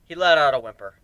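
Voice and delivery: usual speaking voice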